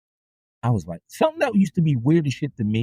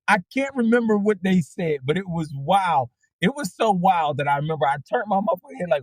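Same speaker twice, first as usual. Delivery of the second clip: ordinary talk, nothing marked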